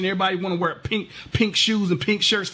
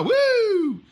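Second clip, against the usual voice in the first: mock woo. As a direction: let out a bragging woo. Mock cheer while driving through Beverly Hills.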